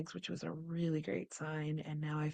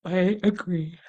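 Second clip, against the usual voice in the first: voice of my body; 3 semitones higher, 12 dB louder than usual. Pretend. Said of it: "my body saying".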